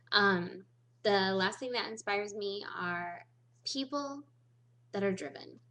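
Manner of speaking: ordinary delivery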